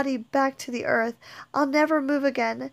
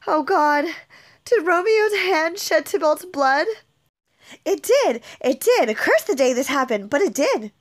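loud, higher, horrified questioning; distraught cry; 3 semitones higher, 5 dB louder than usual. Acted. Juliet to the Nurse, horrified that Romeo killed Tybalt, loud. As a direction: cry out in disbelief, then grim admission.

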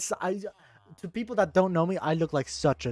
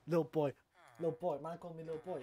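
put-on quiet little boy; 13 dB quieter, faster than usual. Shown as a demonstration. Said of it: "Watch this".

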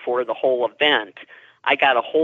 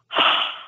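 one audible breathy exhale; big breath. Retold or imitated. Unseen visitor breathes into her ear, big breath. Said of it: "big breath".

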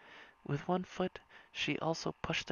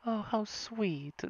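slow, higher fond coo; 6 semitones higher, much slower than usual. A narrator's reading. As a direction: coo it slowly like a doting mom. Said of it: "Miss Diaz cooed".

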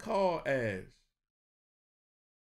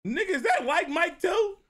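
laughing disbelief; 13 semitones higher, 7 dB louder than usual. Real, not acted.

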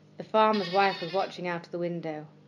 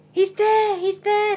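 much higher and louder, thrilled; excited cry; 13 semitones higher, 8 dB louder, slower than usual. Acted. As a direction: shout it out with joy, way up high. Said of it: "cried Peter".

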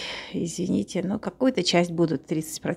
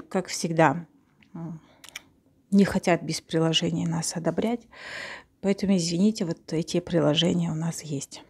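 usual speaking voice

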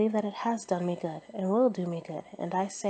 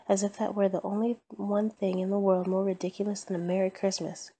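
ordinary delivery

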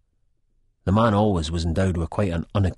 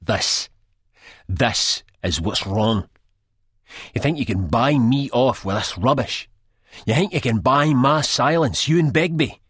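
rising anger; 7 semitones higher, 4 dB louder than usual. A narrator's reading. Second Prize angry at narrator.

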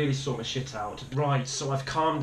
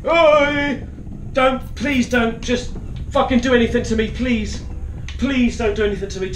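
loud, high panicked pleading; startled yelp, then beg to be left alone; real fear, begging at a raised pitch; frightened pleading; 9 semitones higher, 6 dB louder than usual. Real, not acted. Frightened player pleading with game.

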